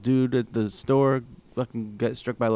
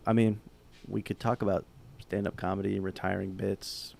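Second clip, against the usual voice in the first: normal talking voice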